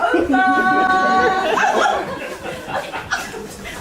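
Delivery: loud high laughing fit; burst of laughter. Real, not acted. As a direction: crack up laughing. Laughing after radio game.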